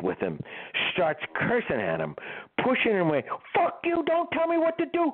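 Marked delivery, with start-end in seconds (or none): 3.6-5.1 higher mock angry yell at managers. Keith pushes his managers away, mock angry yell. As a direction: fling it back, cursing, pitched up.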